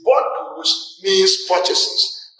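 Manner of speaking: very slow, word by word; slow teaching emphasis; emphasized slowly. Shown as a demonstration. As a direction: spell it out slowly for the learners. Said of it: "the emphasis is for you".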